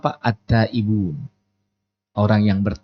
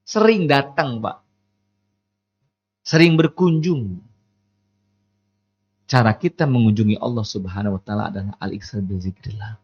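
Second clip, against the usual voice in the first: ordinary delivery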